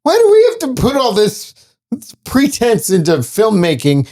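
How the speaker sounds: high, complaining actor voice; mocking a pretentious actor, whining high; whiny mock rant. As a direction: whine it like a fed-up actor.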